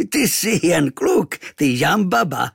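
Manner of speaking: slow, high, loud character voice; chortling native. A narrator's reading. Tattooed roommate amused to find a boy, chortling. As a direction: draw it out loudly, highly amused. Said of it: "rozchechtal se".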